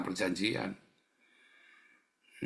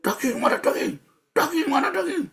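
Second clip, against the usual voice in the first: high-pitched, loud demanding screech; hammed-up shrill complaint; mock shrieking; 5 dB louder than usual. Preacher mimics Israelites screaming for meat in the desert, mock shriek. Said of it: "Jangan kamu jejeritan".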